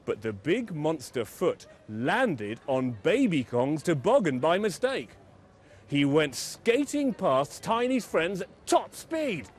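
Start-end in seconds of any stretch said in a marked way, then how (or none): none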